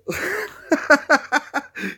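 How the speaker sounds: high, helpless cackle; long high-pitched laughing fit; burst of laughter. Real, not acted. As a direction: crack up after holding it in. Cracking up after the prank.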